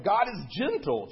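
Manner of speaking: high, loud bird-like lilt; chirping robin. A narrator's reading. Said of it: "chirped the robin".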